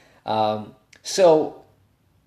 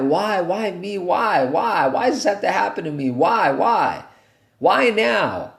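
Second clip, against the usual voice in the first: higher, louder whiny why-me; mock whining; 7 semitones higher, 4 dB louder than usual. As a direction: whine like a self-pitying kid. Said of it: "coming from a whinish attitude".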